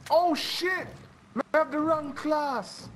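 real surprised outburst, voice jumping up; startled outburst. Real, not acted. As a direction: react in shock mid-game. Sudden game surprise.

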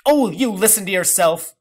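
high, loud outburst; exasperated cry. A narrator's reading. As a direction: cry out in disbelief. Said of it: "cried grandmother".

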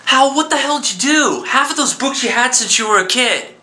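high, alarmed what the hell; shocked outburst at a friend giving away his books. Acted. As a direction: blurt it out in dismay. Friend learns books given away.

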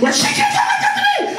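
very high, indignant acted screech; outraged shouting back. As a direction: protest at the top of the voice range. Yelling back at father on phone.

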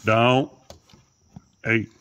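loud, firm command; sharp scolding. Real, not acted. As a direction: stop a pet with one loud word.

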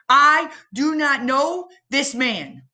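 high, slow, emphatic denial; Peter swearing. Acted. Peter swearing to the crowd, slow insistent denial. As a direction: insist slowly, a lightly voiced frightened Peter. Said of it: "he swore on an oath and said".

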